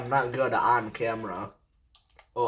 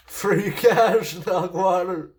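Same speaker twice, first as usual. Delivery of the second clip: loud, high mocking jeer; taunting; 6 semitones higher, 6 dB louder, slower than usual. Real, not acted. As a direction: rub it in with a laugh. Trash talk at enemy.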